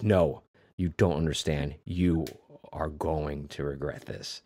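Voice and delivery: measured movie menace; quiet threat. Retold or imitated. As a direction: soften and space out the threat. [name] repeats threat to Charlie.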